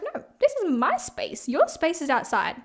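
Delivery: high-pitched slow telling-off, drawn out; high indignant scolding. Retold or imitated. Telling the lizard off, high and indignant, slow. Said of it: "I was like".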